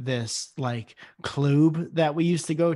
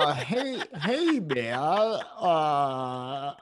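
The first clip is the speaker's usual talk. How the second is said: lightly voiced drawling cowboy; Jeff Bridges impression; much slower than usual. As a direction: drawl it like a laid-back cowboy. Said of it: "same as my Jeff Bridges impression".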